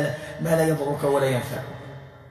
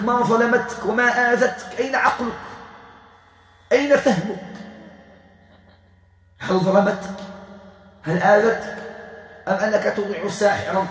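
higher-pitched, indignant questioning; scolding; 6 semitones higher, 3 dB louder than usual. Real, not acted. Healer rebuking the spirit that obeys a sorcerer, indignant. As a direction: challenge them with pointed rebuking questions.

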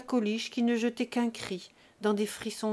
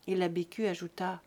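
ordinary delivery, nothing marked

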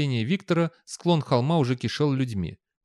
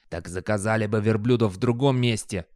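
plain reading voice

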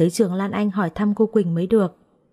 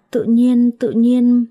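put-on loud, high childish outburst; lightly voiced child, loud and high; excited child blurt; 4 semitones higher, 4 dB louder, much slower than usual. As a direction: blurt it out like an eager little kid.

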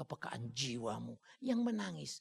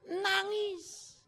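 mock wail; 3 dB louder than usual. Mocking easy crying.

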